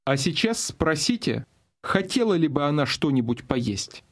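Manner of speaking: ordinary talk, nothing marked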